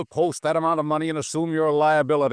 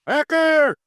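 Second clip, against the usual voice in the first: yelling a name; 13 semitones higher, 6 dB louder than usual. Acted. Old man bellowing for his assistant from bed, loud yell. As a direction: call the name out, much higher and louder.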